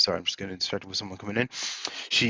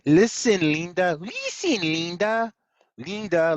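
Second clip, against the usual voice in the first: high, insistent sing-song calling; a higher, pleading call of listen Linda; Rima-style call; 7 semitones higher, slower than usual. Pretend. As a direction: nag Linda by name like Rima would. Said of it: "think of it like".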